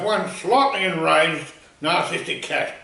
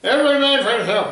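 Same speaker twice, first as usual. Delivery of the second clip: mock yell; 7 semitones higher, 4 dB louder than usual. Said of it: "one man even yelled".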